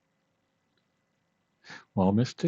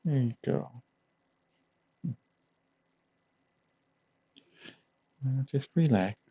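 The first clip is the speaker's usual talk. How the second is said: higher, softer soothing voice; 6 semitones higher, 4 dB quieter than usual. Acted. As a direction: settle them down gently. Soothing friend lying on lap.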